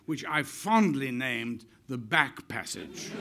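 usual speaking voice

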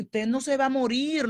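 ordinary talk

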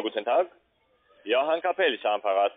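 ordinary delivery

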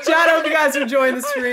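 loud upbeat streamer sign-off; streamer outro. Acted. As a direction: announce it to chat with energy.

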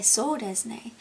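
"So desu ne" said as a learner would; Japanese phrase. Shown as a demonstration. Showing viewers how to agree, in learner Japanese. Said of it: "you'd say".

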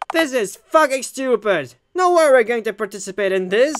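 loud, angry yell of defiance; furious outburst. Acted. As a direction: blow up at the captor. Jeff erupts at the host.

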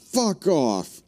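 high, loud startled curse; startled yell. Real, not acted. Streamer cursing at a jump scare, startled yell. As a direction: blurt it out in fright.

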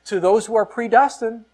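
mock wrong reading. Shown as a demonstration. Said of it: "He didn't say".

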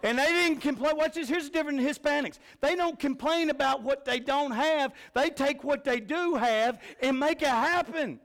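high, loud, hammering sermon delivery; full-force preaching. Acted. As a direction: preach it out to the whole church.

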